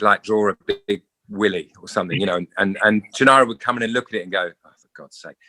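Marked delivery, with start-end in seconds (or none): 4.6-5.4 low, muttered exasperation; fed-up chef, barely audible; weary exasperated sigh. Retold or imitated. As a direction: groan it out under your breath. Chef finds the flour drawing.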